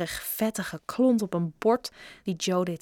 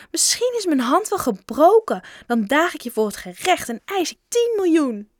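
put-on high, loud little-girl voice; indignant girl making threats; girl crying out; 7 semitones higher, 5 dB louder than usual. A narrator's reading. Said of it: "riep ze". (verontwaardigd roepend meisje)

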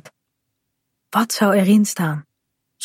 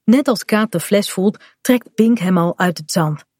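usual speaking voice

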